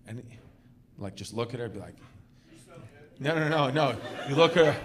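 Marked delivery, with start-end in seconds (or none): none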